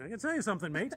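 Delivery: put-on Australian twang for a quoted driver; Australian accent. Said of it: "in Australian accent".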